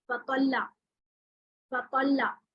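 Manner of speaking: फतल्ला with the doubled ल held and pressed before a falling end; doubled lam shown. Shown as a demonstration. Teacher demonstrating the doubled ल in फतल्ला to students. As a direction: hold the ल in फतल्ला, then let it fall. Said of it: "एक लफ्ज होता है फतल".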